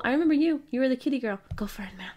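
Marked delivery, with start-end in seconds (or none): 1.6-2.1 soft whispered go for it, man. Acted. Waving the next guest in with a whispered go-ahead. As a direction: whisper it as if to yourself.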